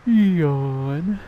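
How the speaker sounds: a big open-mouthed yawn; loud yawn. Real, not acted. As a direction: let a full yawn out. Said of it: "Partial yawn, not a big yawn".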